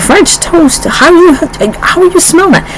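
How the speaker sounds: high, disbelieving mock question; high-pitched imitated disbelief at her nose; incredulous family member. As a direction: play the baffled relative who can't believe it. Family baffled by her smelling.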